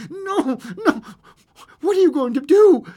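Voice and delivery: high, urgent alarm; hoarse panic. A narrator's reading. Husband holding back his wife, hoarse panic. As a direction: plead in rising panic. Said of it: "he whispered hoarsely".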